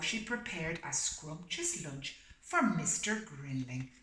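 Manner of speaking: steady storybook reading voice; story narration. A narrator's reading. Reading the story aloud to a listening pet, storybook voice. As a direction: read it like a bedtime picture book.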